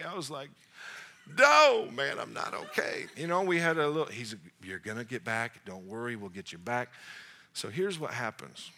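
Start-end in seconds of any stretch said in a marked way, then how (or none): 1.4-3.1 upset cry. Retold or imitated. Answering the coach after crying. 4.6-6.9 reassuring coach. Retold or imitated. Coach comforting injured player.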